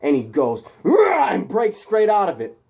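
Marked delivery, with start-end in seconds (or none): 0.9-1.3 very high, loud angry growl; mad roar. Acted. Peter getting mad and breaking out, furious roar. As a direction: roar as you burst free. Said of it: "He then gets mad".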